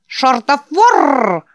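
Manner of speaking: slow, high, harsh buzzing screech; buzzy creaking spider voice. Acted. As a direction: creak it out like a giant insect. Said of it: "проскрипел он своим резким жужжащим голосом".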